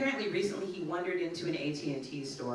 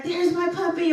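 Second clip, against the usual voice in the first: high, louder, delighted call to a pet; excited high greeting; 14 semitones higher, 10 dB louder than usual. Retold or imitated. Gangbanger spots the dog across the street, excited high call. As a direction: gush over the dog like a long-lost friend.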